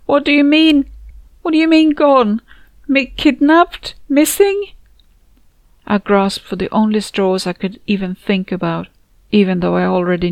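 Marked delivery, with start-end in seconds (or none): 0.1-4.7 high, louder, panicked questions; disbelief. Acted. Friend hears the awful news, rising panicked disbelief. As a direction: grasp desperately for another answer. Narrator reacts to shocking news.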